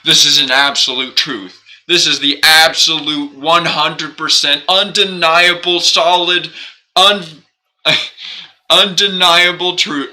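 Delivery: hammed-up proclaiming, slowing on the last words; mock grand proclamation. Streamer mocking absolute truths in a grand, loud voice. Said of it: "it's like".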